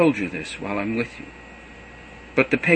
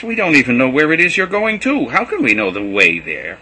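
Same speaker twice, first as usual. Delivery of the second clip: louder, higher, baffled; exasperated protest; 5 semitones higher, 7 dB louder than usual. A narrator's reading. Thomas protesting to Jesus, louder and baffled. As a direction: throw up your hands, we have no idea.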